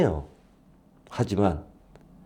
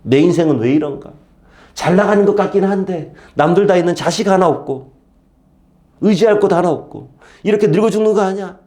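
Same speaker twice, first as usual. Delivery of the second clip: mock self-pity; 7 semitones higher, 3 dB louder than usual. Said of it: "기가 팍 죽어서".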